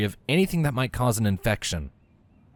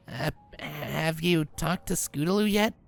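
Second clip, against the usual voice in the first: hesitant; 8 semitones higher, 3 dB quieter, slower than usual. Acted. Rainbow asks, still processing.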